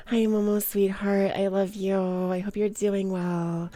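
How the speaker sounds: normal talking voice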